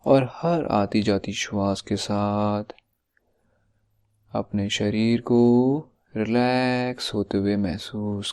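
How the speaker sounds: unhurried meditation voice with long pauses; guided meditation. Acted. Life coach leading listeners into yoga nidra, guided meditation voice. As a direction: ease each phrase out, drawing the endings long.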